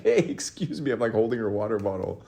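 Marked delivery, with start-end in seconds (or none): 0.0-0.9 calling out. Retold or imitated. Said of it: "And I'm like".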